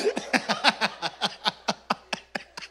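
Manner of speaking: quiet laughing at own joke; real laugh. Real, not acted. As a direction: chuckle softly.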